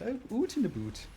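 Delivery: high Canadian "oot in a boot"; high-pitched imitation of a Canadian accent. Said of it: "and she went".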